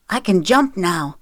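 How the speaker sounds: put-on mildly boastful child's announcement; lightly voiced proud kid; proud little boy. A narrator's reading. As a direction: show off your new skill proudly. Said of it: "Milo said proudly".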